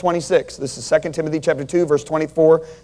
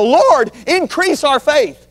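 desperate cry; 7 semitones higher, slower than usual. Acted. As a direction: beg the Lord out of desperation, slowly. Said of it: "out of desperation".

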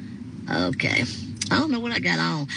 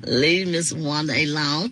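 ordinary talk

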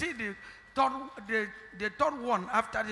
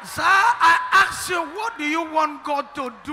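raised, slowed, insistent call; loud demand; 5 semitones higher, 11 dB louder than usual. Real, not acted. Prophet to the church, a loud demand. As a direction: press them for an answer.

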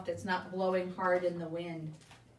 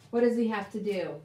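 ordinary talk, nothing marked

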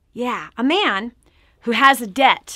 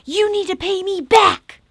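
higher, louder demand; 7 semitones higher, 4 dB louder than usual. Retold or imitated. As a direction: demand the money back harshly. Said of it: "demanding debtor repay".

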